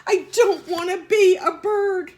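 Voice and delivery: high, loud desperate honk; honking protest. Acted. Eddie refusing to stay a bird, honking loudly. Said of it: "he honked".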